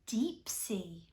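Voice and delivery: hushed whispered title; mysterious whisper. Acted. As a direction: breathe it like a secret.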